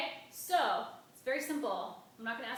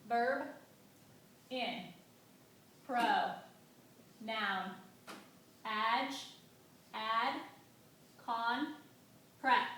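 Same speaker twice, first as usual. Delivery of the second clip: each syllable alone, a long pause after each; much slower than usual. Shown as a demonstration. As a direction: dictate it one syllable at a time. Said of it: "this entire nonsensical word into syllables".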